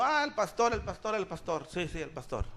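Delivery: soft, trailing-off mock acknowledgement; imitating half-hearted listeners. Preacher imitating sheep who only seem to notice the pastor, soft mock murmur. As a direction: mimic a murmured, repetitive nod to someone. Usted lo puede ver a uno.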